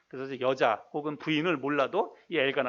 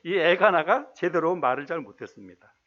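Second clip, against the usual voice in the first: normal talking voice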